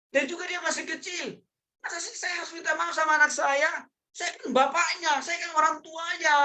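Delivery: very high, hammed-up parental protest; indignant parent. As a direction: pull rank on the child in mock outrage. Acting a parent refusing to apologise. (orang tua gengsi protes, melengking dibuat-buat)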